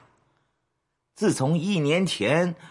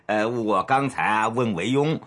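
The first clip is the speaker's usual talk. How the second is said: ordinary talk, nothing marked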